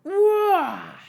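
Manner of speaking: startled wail. Acted. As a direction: yelp out a shocked cry. Character reacts in manga.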